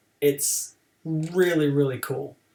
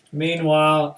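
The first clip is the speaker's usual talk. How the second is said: normal talking voice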